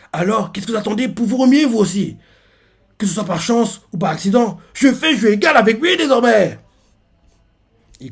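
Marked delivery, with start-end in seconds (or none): 0.1-6.6 high-pitched bold proclamation; rally the allies, climbing to a defiant finish; acted boastful speech; rousing challenge. Baggy exhorts allies.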